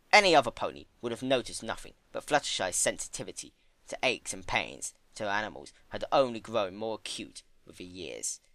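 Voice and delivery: usual speaking voice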